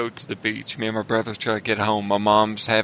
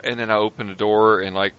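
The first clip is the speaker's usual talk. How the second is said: ordinary talk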